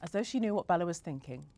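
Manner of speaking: ordinary delivery, nothing marked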